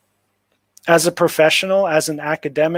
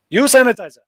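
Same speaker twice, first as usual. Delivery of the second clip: curt local staff; 7 semitones higher, 4 dB louder, faster than usual. Retold or imitated. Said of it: "That's how they talk".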